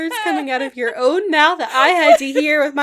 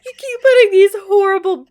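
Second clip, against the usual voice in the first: crying with laughter; laughing; 4 dB louder than usual. Real, not acted. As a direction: talk through helpless laughter. Laughing so hard she cries.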